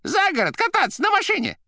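loud, very high squeaky cry; shouting down phone. A narrator's reading. The receiver explaining the trip, shouting in a squeak. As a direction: squeal the news out brightly. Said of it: "кричала трубка".

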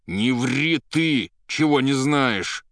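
loud, high, drawn-out scolding; angry rebuke. A narrator's reading. Poet rebukes Ryukhin for lying, angrily. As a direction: slowly hurl it at the liar. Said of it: "рассердился на Рюхина Иван".

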